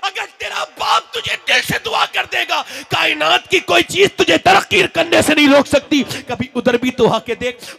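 high, loud, shouted sermon building to a roar; fiery preaching. Acted. Preacher urging the crowd to honour their fathers, roaring. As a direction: thunder at the crowd like a street preacher. Preacher rises to full force.

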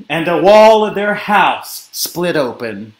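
ordinary delivery, nothing marked

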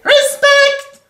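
high belted respect through the nose. Retold or imitated. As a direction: belt respect nasally.

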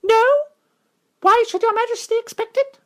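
high, small, proud mouse voice; a tiny gallant knight, firm and noble; brave mouse knight. Acted. Reepicheep answering Lucy in a high, proud mouse voice.

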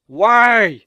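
loud, exasperated cry; exasperated wail. Real, not acted. Gamer fed up with pink guys, exasperated wail. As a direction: wail it out in frustration.

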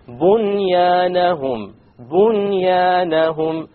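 Bunyanahum drawn out slowly with a clear noon, no merging; recited clearly. Shown as a demonstration. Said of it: "noon sakin followed by a ya".

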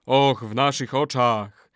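slow, drawn-out crowd echo; chorus response. A narrator's reading. Warriors echo the old man's curse, chanting.

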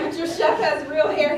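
high, loud mock boast; teasing comeback. Chef teases client about her hair, loudly. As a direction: crow it loudly at him.

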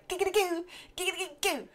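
high, giddy Quagmire catchphrase; Quagmire impression. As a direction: sing out the catchphrase like a lecherous neighbour.

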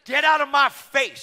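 high, loud, drawn-out furious yell; screamed outburst. Retold or imitated. Flight attendant at preacher on the plane, screaming. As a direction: scream it right in their face. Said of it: "Screams at me".

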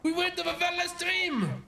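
high-pitched exasperation; exasperated protest. Real, not acted. Player chiding a forgetful teammate, exasperated. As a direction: scold a friend in high, incredulous disbelief. Scolding teammate who forgot a tip.

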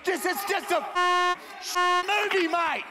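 very high strained cry; mock hysterical yell. Prank caller to rioting crowd, high hysterical pleading. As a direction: wail it at an attacker in pretend panic.

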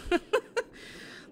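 high embarrassed giggle. Real, not acted. Embarrassed laugh.

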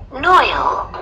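put-on high little girl voice, falling tune. A narrator's reading. Little girl answering her teacher, high child voice.

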